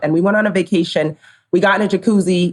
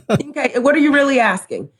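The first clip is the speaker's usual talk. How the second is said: laughing; 8 semitones higher than usual. Retold or imitated. Laughing retelling of jacuzzi exchange.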